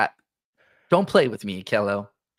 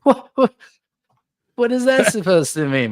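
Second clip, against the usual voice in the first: high indignant what; mock offended; 11 semitones higher, 3 dB louder than usual. Real, not acted. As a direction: play it hurt and outraged. Mock offended at age joke.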